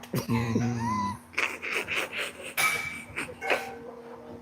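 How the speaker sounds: high shrieking laugh. Real, not acted. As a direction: howl with laughter. Laughing at his own impression.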